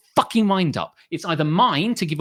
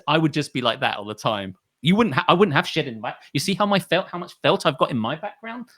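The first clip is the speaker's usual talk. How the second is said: usual speaking voice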